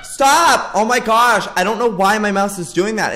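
high, loud cry easing down; panicked protest. Real, not acted. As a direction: protest in a fluster after a fright. Mouse turns view during scare.

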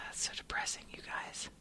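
very soft, deflated whisper; real downhearted aside, barely voiced; dejected whisper. Real, not acted. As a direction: whisper it on the tail of a sigh. Disheartened aside after a sigh.

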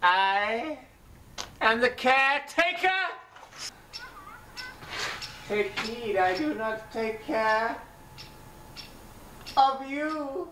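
a spooky bearded caretaker warning guests; ominous caretaker. Acted. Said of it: "you strange bearded man".

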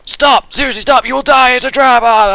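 high loud frantic warning; yelling a warning to the viewer. Retold or imitated. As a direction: shout it up high to stop him. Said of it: "he gets mad and cheats".

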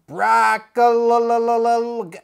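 drawn-out ring introduction, syllables stretched; Paul Heyman. Acted. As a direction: announce the wrestler very slowly, stuttering out the name. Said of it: "Said it just like Paul Heyman".

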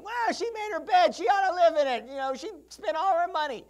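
high, loud scornful scoff; scoffing judgment. Retold or imitated. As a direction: sneer at her like a stern judge. Pastor voicing his judgmental thought.

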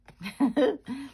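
high, bright little laugh at herself; short self-conscious laugh; light laugh. Real, not acted. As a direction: laugh off the mistake. Laughs at her own mistake.

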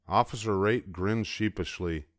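usual speaking voice

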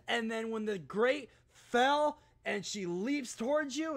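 ordinary talk, nothing marked

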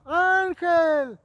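loud high call of a nickname. Retold or imitated. Sister looking for her brother, loud high calling. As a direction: call out to find him in the crowd.

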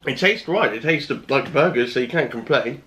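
speaking with food in mouth; words between chews; talking while chewing. Real, not acted. Seen 2: Just took a bite; jaw works while talking, fork near mouth.

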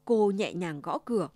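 plain reading voice

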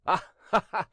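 acted mocking laugh; crying out with laughter. A narrator's reading. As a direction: burst into a stagy laugh. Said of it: "cried Jeannin".

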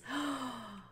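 sharp little gasp of delight. Real, not acted. Touring a cute house, gasping with delight. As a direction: gasp at something adorable.

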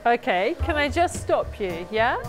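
normal talking voice

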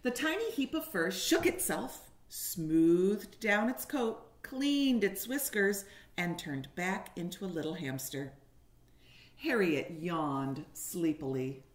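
put-on lively storybook reading for little kids; reading to children. A narrator's reading. Teacher reading a picture book to kindergartners, animated. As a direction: paint each moment of the story for the class.

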